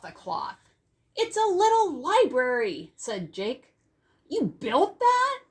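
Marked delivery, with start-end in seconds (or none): 1.2-2.9 higher and louder, beaming announcement; proud excited reveal. Acted. Boy unveiling his gift to the class, loud proud reveal. 4.3-5.4 very high, louder, slow astonished question; amazed child voice. Acted. Classmate amazed at the boy's work, high slow astonishment.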